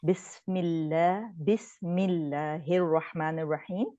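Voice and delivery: بسم اللہ الرحمن الرحیم recited slowly and carefully; slow correct recitation. Shown as a demonstration. Teacher recites the correct Bismillah slowly. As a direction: read out بسم اللہ slowly, each sound clear. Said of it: "teacher recites correctly".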